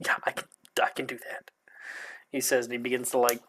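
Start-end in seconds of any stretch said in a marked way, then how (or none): 0.1-1.5 whisper. Acted. Character whispers agreement.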